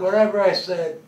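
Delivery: drawn-out, loud, high retort; angry. Acted. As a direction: shut them down, loud and slow. Angry father refusing to repeat himself.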